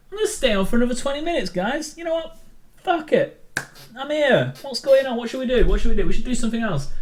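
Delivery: high, loud, rapid burst; giddy, over-the-top rallying cry; manic excitement. Pretend. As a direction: blurt it out high and frantic, playing it up. Sudden mock-manic decision to stay on.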